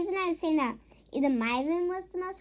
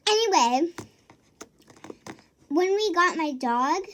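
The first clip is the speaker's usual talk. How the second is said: ordinary talk, nothing marked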